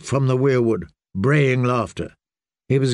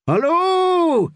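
high, far-carrying call of hullo. A narrator's reading. Nimble Dick calling out to find anyone hiding. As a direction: shout it into the empty ruin. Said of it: "he called again".

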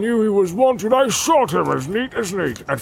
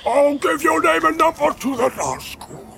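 threatening orc. Acted. Soldier threatening.

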